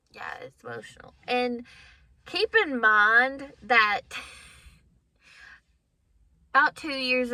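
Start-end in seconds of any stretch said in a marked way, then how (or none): 0.1-1.1 whispered, choked-up admission; barely voiced, fighting emotion; holding back tears. Real, not acted. Telling viewers about her son, whispered and choked up. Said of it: "So I'm trying not to get emotional".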